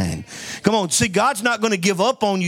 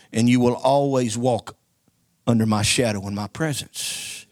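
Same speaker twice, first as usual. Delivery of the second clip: solemn voice of Jesus; 7 semitones lower than usual. Retold or imitated. Jesus commissioning Peter.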